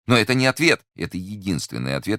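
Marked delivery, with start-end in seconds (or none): 0.1-0.8 high, louder objection; agitated protest. Acted. Prisoner objects to the official's evasive reply, agitated. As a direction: object in raised alarm.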